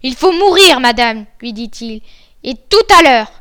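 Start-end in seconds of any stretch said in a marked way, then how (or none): 0.0-1.2 loud, raised pitch on the verdict; stern death sentence. Acted. Bluebeard tells his wife she must die, loud and stern. 2.4-3.3 loud, forceful order; emphatic threat. Acted. Bluebeard gives his wife no delay, loud and threatening.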